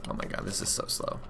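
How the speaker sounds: whispered, fed-up groan; exasperated whisper. Real, not acted. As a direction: breathe it out, bored stiff. Whispered exasperation at slow game.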